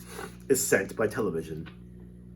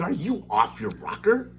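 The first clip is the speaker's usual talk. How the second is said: Wonka scolding; 8 semitones higher, slower than usual. Acted.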